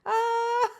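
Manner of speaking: very high, loud giddy squeal; giddy squealing laugh. Real, not acted. Giddy over teacher crush.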